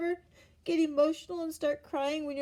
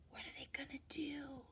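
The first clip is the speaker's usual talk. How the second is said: fast, much softer conspiratorial whisper; 6 dB quieter than usual. A narrator's reading. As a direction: whisper as if not to wake the farmer. Animals sneaking in.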